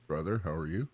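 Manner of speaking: normal talking voice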